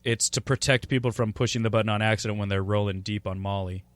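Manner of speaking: ordinary talk, nothing marked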